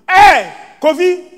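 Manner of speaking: loud call across the house, pitched high; shouted call. Retold or imitated. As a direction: yell the son's name from the bedroom. Father shouting to son in bathroom.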